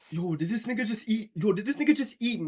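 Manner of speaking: high, incredulous joking voice; mock disbelief. Roasting a friend over what he ate, mock disbelief. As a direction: act shocked at your friend as a joke. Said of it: "Sometimes I'll be like".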